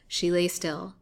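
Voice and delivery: plain reading voice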